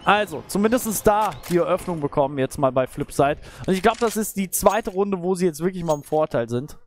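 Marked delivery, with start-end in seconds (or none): none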